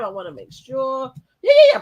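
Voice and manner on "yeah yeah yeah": loud, high mocking yeah; mock yelling. Mocking the plan, loud high sarcastic yeahs. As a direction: shout it mockingly.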